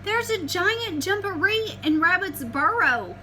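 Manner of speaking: cat blurting the news; alarmed telling. Acted. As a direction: rush it out in a fright.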